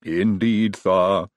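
smug lisping henchman. A narrator's reading. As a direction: answer with self-satisfied servility. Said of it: "said Igor smugly".

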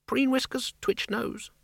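high, soft little rodent voice; a small creature piping up; tiny squeaky mouse. Acted. Rat ghost explains.

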